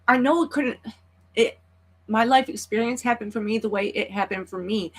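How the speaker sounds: high, tight voice close to crying, real sorrow; holding back sobs while explaining; near tears. Real, not acted. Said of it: "Try not to cry".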